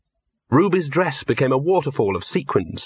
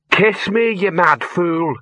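demanding troll woman; 6 semitones higher, slower than usual. A narrator's reading. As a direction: throw the line at him, then drag it out. Ruby demands a kiss.

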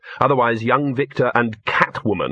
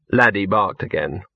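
usual speaking voice

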